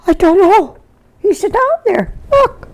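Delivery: very high, frantic old-lady imitation; little old lady. Little old lady pointing neighbour to the well, frantic. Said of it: "this little old lady, she says".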